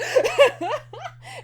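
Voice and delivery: bright squeal of laughter; high giggle burst. Real, not acted. Fan giggling at the prisoner's letters, high squeal. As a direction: giggle helplessly.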